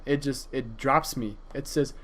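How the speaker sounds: ordinary delivery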